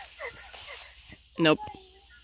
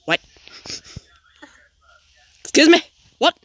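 higher, louder confused mom; a mock alarmed mom; 6 semitones higher, 3 dB louder than usual. Teen imitating his mom surprised in the car, higher and louder. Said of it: "and she's like".

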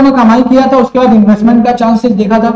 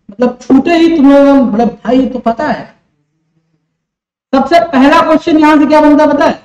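ordinary talk, nothing marked

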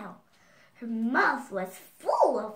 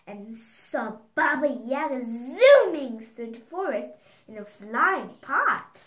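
dramatic narration; 8 dB louder than usual. A narrator's reading. Dramatic witch sighting.

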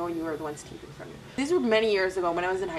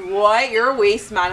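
loud, indignant telling-off; angry scolding; 8 dB louder, slower than usual. Retold or imitated. Retelling how she scolded the fruit man, indignant and loud. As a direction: let him have it for doing nothing.

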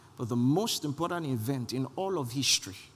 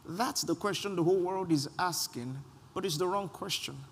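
normal talking voice

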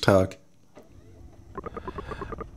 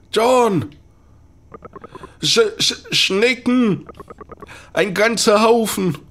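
panicked yelling; 10 semitones higher, slower than usual. Acted. Doctor yelling a warning to John about slugs, high panicked yell. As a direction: shriek a warning at the swarm.

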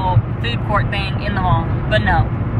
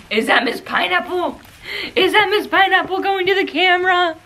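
high, giggling coo; 11 semitones higher than usual. Real, not acted. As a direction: giggle at the puppy. Laughing at puppy.

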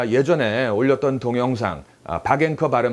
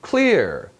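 "Clear" pitched higher and punched; emphatic, falling "Clear"; stressed CLEAR; 5 semitones higher than usual. Shown as a demonstration. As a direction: put firm stress on "Clear". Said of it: "강세를 넣어줍니다".

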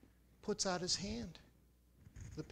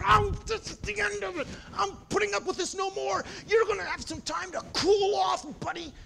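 much higher and louder, yelling at the bird; furious shout; 14 semitones higher, 11 dB louder than usual. Acted. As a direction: lose it completely at the parrot. Man furious at the parrot.

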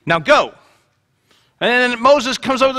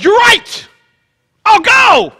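mock eager Moses, much higher and louder; eager shout; 12 semitones higher, 7 dB louder, slower than usual. Preacher joking Moses finally agrees, hammed-up eager shout. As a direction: shout it like a sudden eager volunteer. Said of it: "notice Moses goes".

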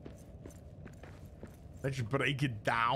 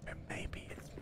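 whisper; 12 dB quieter, faster than usual. Pretend. Whispered self-answering bit.

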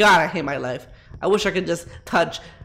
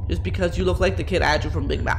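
normal talking voice

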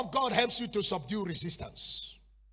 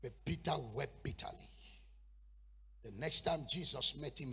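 usual speaking voice

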